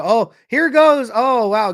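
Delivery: loud, high burst of real excitement; wowed live commentator; excited outburst. Real, not acted. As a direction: react loudly as the chance opens up. Sudden chance in live play.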